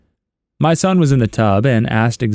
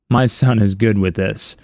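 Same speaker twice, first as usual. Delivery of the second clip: ordinary delivery, nothing marked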